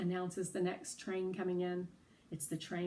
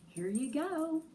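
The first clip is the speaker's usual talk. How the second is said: friendly and confident; 7 semitones higher than usual. Acted. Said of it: "as friendly and as confident as I can".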